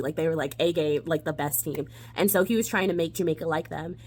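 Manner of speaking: normal talking voice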